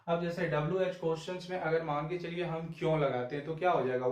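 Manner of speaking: usual speaking voice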